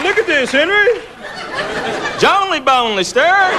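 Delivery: high-pitched, incredulous read-out; amused disbelief. Retold or imitated. Clerk showing Henry a ridiculous name, high disbelief. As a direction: call a colleague over, pitched high and questioning.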